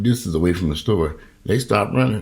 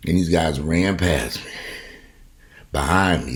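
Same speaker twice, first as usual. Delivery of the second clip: ordinary talk, nothing marked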